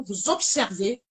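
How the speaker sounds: normal talking voice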